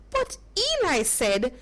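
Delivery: usual speaking voice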